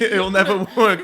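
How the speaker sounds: laughing through the line. Real, not acted. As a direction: giggle the words out. Echoing joke while laughing.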